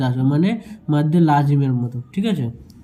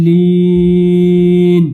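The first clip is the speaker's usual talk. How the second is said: "লিন" held long and loud on one note; stopping form; 6 dB louder, much slower than usual. Shown as a demonstration. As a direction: stretch the i of "লিন", then close on n. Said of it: "লাস্টে যখন থামবো তো".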